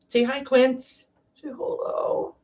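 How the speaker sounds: high, sing-song pet voice; talking to her pet. Real, not acted. Introducing her pet to viewers.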